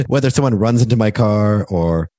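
normal talking voice

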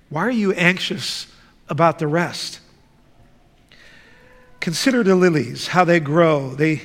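ordinary talk

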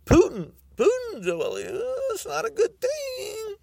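high, crying mock lament; hammed-up sobbing over Putin; mock wail. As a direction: wail it like fake grief.

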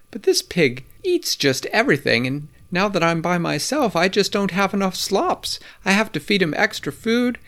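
put-on a fussy old woman listing her pig's faults. A narrator's reading.

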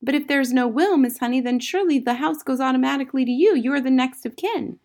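put-on insistent young Matilda. A narrator's reading. Matilda reasoning with Miss Honey, high and insistent. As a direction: argue urgently like a little girl.

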